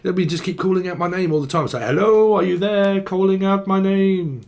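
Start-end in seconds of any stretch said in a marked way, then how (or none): 1.8-4.4 high, drawn-out mock call; singsong raised hello. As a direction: call out as if seeking someone. Acting out calling someone's name.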